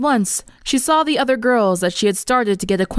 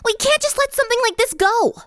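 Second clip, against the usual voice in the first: much higher, frowning, determined; earnest tomboy. Acted. Rainbow Dash urges friends to see the principal, determined. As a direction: push for going to the principal.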